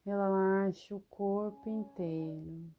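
ordinary delivery

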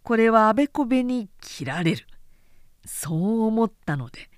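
usual speaking voice

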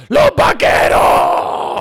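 imitating the singer's random yell; shouted ad-lib. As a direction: drag it out like a stage hype shout. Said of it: "te empieza a gritar como".

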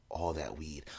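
hushed, barely voiced admission; whispered confession. Friend admits the stash mid-search, whispered. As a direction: admit it under your breath.

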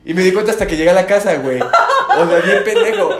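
loud, laughing self-mockery; laughing at his own cluelessness. Real, not acted. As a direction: laugh at yourself.

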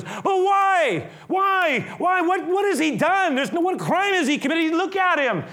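high-pitched, insistent appeal; pleading with mob. Retold or imitated. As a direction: act out desperate reasoning with a crowd. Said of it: "he starts to reason with the mob".

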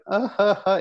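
high, feeble forced sobs; bad fake crying. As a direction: fake a sob badly, like a kid. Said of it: "You call that crying?".

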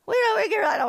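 high mock-airy receptionist brush-off; dismissive church staff. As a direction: shrug me off with a giggle. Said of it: "they're just like".

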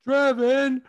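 loud, high, drawn-out name shout; mock ring announcer. Announcing a fighter's rude nickname, mock ring announcer. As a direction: announce the silly nickname to a whole arena.

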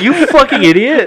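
high, slow, loud yell; acted exasperated shouting; exasperated insult. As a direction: holler it, drawing the words out.

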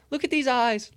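high, excited call to look. Real, not acted. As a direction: point at the eyes, thrilled and high. Zooming in on NFT eyes.

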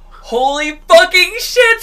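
high, loud, drawn-out reenacted shock; shocked exclamation. Friends watching a drunk man hit a door, shocked yell. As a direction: exclaim it slowly in stunned alarm.

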